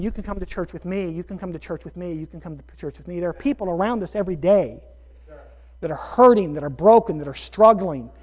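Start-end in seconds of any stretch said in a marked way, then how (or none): 0.0-3.2 inviting. Retold or imitated. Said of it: "mutual friends of ours saying".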